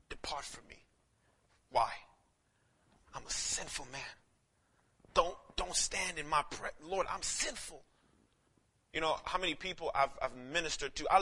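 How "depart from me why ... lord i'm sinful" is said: Peter's quiet plea. Acted. Peter's confession, soft.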